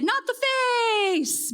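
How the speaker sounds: high, drawn-out plea; dramatic high-pitched protest, played for laughs; mock cry of alarm. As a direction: stretch it out high like a slow-motion wail. Imagined cry while shielding from impact.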